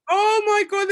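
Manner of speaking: high, loud oh my God; delighted squeal. Real, not acted. Guest reads cushion slogan, squealing with delight. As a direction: squeal at the joke.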